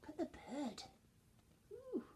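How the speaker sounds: ordinary delivery, nothing marked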